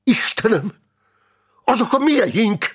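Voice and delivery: high-pitched cry of dismay; alarmed cry. Acted. Soldier spotting own men outside the cathedral, alarmed cry.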